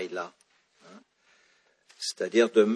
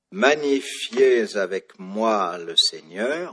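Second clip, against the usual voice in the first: "Magnifiez avec moi le Seigneur" slow and loud, leaning on three stressed syllables; three accented syllables; 9 dB louder, slower than usual. Shown as a demonstration. Teacher showing French accents to students, "Magnifiez avec moi le Seigneur" heavily stressed. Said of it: "j'ai trois syllabes accentuées".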